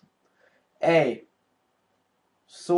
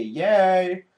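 normal talking voice

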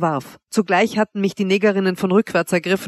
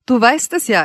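higher, louder spiteful retort; malicious; 5 semitones higher, 5 dB louder than usual. A narrator's reading. Wanda answers her suffering lover, maliciously. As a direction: needle with relish. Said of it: "entgegnete sie boshaft".

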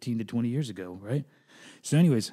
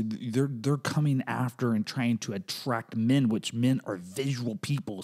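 ordinary talk

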